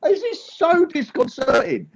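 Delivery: high-pitched, disconcerted yelling; screaming into a screen. Real, not acted. Comedian disconcerted by talking to a silent screen, high yelling. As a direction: protest at full voice to an unseen crowd. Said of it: "I'm just screaming".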